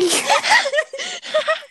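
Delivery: shrieking burst of laughter; high squealing laugh. Real, not acted. Friend laughing at her own Minecraft joke, high squeal.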